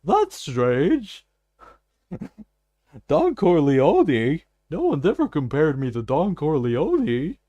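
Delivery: hammed-up copy of a cartoon game character; game character impression. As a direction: mimic the game's smooth cigar-offering guy, drawn out. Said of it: "he's got a voice I can".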